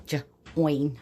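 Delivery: "J-, oin" split after the first sound; a lone J, then oin. Shown as a demonstration. Teacher blending join for children, J sounded apart from oin. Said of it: "spelling to say".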